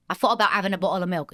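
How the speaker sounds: quick English-accent prompt phrase. Shown as a demonstration. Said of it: "one of the prompt phrases".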